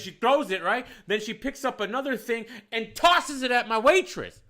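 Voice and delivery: ordinary talk, nothing marked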